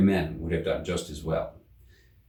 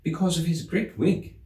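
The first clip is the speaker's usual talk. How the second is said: put-on higher young girl's voice; childlike Alice; 8 semitones higher than usual. A narrator's reading. Alice explaining to herself, higher girlish voice. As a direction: play it as a curious little girl. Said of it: "she said to herself".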